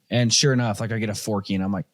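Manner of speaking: ordinary talk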